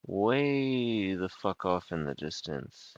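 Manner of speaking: slow, with "Way" stretched long; drawn-out emphasis. Acted. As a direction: drag out "Way" to show how far. Game master repeats line to stress distance.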